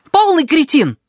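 high, shrill angry yell; shouting match. Acted. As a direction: scream the insult at him. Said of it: "Они орали друг на друга".

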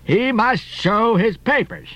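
slow, high-pitched gruff captain; gruff, deliberate sea captain; Captain Peleg. A narrator's reading. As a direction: lay down the rule gruffly, drawn out. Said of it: "in his gruff voice".

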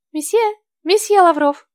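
high, thin maid calling; thin female voice. A narrator's reading. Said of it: "Чужой тоненький женский голосок".

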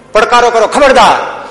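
high, loud battle challenge; warning challenge. Retold or imitated. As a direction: act out a rescuer halting the attackers. બચાવવા આવેલા ભાઈઓનો પડકાર. (ઊંચા અવાજે ખબરદારનો પડકાર)

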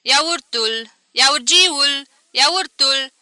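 loud, high hawker's cry; street vendor calls. A narrator's reading. Yogurt seller Bogov hawking in the streets, loud calls. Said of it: "strigând".

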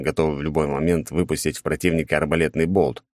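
ordinary delivery

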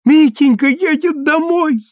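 high, loud, joyous exclamation; old woman's elated outburst; overjoyed cry. A narrator's reading. Old mother jumps up overjoyed.

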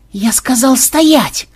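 high, slow threatening command; repeating the order menacingly. A narrator's reading. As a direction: threaten them. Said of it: "грозно повторил тестер".

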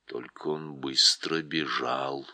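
put-on a wise old voice, unhurried; deep calm voice. Голос Льва. (низкий ласковый голос)

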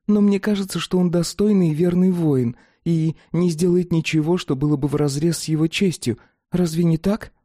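high, soothing woman's voice; calm young woman voice. Acted. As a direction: reassure her gently, in character. Plavtina calming Fatida.

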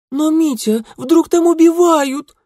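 put-on high, loud girlish exclamation; excited cry. A narrator's reading. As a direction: cry it out in theatrical alarm. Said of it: "вскричала одна".

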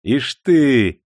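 с ехидной усмешкой, громче; насмешливо, свысока; ехидно. A narrator's reading. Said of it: "ехидно усмехнулся Никита". (Никита ехидно усмехается над толпой)

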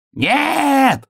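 high, drawn-out yell; орёт. A narrator's reading. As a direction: yell it high, holding the vowel. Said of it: "орал заяц". (высокий протяжный вопль)

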